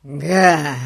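loud, scornful "ha"; contemptuous exclamation. A narrator's reading. Гун scoffs at the old man's answer, contemptuous. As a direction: throw it out with open contempt. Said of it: "презрительно воскликнул Гун".

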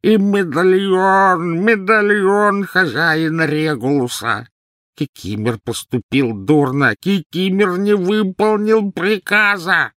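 loud, high, slow drawn-out howl; elf's blood-curdling wail. A narrator's reading. Elf confessing he failed his master, wailing. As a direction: wail in anguished self-blame. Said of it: "издал леденящий крови вопль".

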